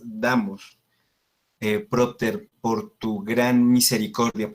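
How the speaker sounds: ordinary delivery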